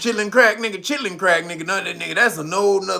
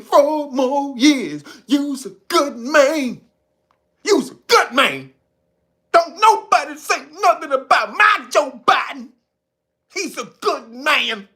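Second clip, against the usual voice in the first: mock Biden voter; 7 semitones higher, slower than usual.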